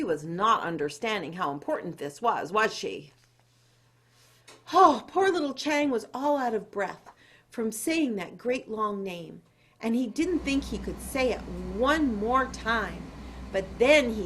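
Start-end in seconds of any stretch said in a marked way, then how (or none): none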